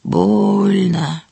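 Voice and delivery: put-on drawn-out croak of an old mushroom; hoarse, long-held complaint. A narrator's reading. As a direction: groan the word slowly in a husky voice. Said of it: "Отвечает боровик хрипучим голосом".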